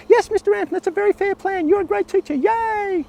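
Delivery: put-on squeaky high, eager boys' chorus; a keen ten-year-old reciting back; excited kids. Boys answering the teacher.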